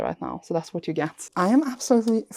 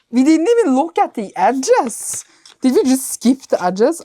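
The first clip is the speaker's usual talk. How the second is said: surprised; 6 semitones higher, 6 dB louder than usual. Real, not acted. Suddenly remembers the edges.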